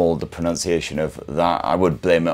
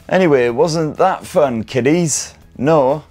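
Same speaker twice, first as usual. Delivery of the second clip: high, bright-eyed sing-song asking the kiddies; faux-cheery kids' TV host, pitched up; mock children's host; 8 semitones higher, 3 dB louder, slower than usual. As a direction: ham it up like a cheery kids' presenter.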